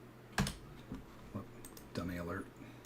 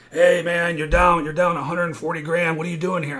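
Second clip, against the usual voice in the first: loud, high, urgent caller yelling about losses; retold angry phone call; 8 semitones higher, 15 dB louder than usual. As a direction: shout it like a panicked risk manager on the phone. Trading firm caller on phone.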